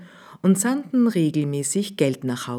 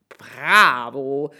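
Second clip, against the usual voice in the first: tailor's bravo; 4 dB louder than usual. Acted. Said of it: "rief der Schneidermeister".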